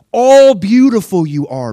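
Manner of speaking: high, loud, slow, stressing ALL; adoring Jesus voice. Shown as a demonstration. Jesus to his bride, stressing All in high adoration. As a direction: lift ALL as the next level of praise. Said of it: "He goes".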